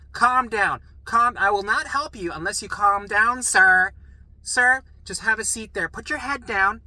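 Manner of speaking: loud, high commands; stern yelling. Retold or imitated. Nurse ordering a frantic patient to sit, stern yelling. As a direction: imitate a fed-up nurse shouting orders.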